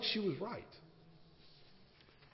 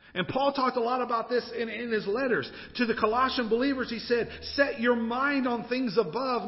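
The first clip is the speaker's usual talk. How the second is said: driving, raised preacher's delivery; preaching; 6 semitones higher, 5 dB louder than usual. Acted. Preacher urging a congregation with Paul's letters, emphatic. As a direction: preach it with rising urgency.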